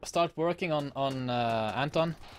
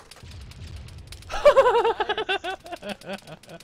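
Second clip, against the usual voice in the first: loud high laugh; 5 dB louder than usual. Real, not acted. Laugh after weapon fires.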